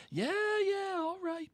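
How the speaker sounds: very high, bored brush-off; high sing-song brush-off; dismissive Ron. Acted. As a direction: wave him off.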